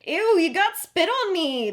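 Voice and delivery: high, loud, slow cry of disgust. A narrator's reading. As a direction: shriek it in disgust. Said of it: "they cried with disgust".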